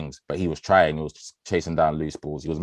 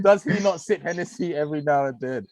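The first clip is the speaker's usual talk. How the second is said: mock disbelief; 10 semitones higher than usual. Real, not acted. Repeats joke.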